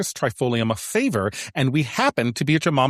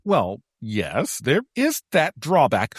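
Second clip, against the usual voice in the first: usual speaking voice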